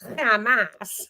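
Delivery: HAMAS drawn out slowly with mock menace; scary oomph. Commentator mocks how the scary word is used, mock-menacing.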